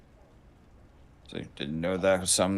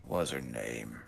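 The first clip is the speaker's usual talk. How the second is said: put-on low, quiet, trailing old man murmur; old man mumbling; 5 semitones lower, 7 dB quieter than usual. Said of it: "starts slipping into old man mumbling".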